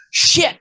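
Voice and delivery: high "shit" ending in a crisp, punched T; hard T. Shown as a demonstration. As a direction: hit the T at the end of "shit" really hard. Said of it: "you have to hit the T really hard".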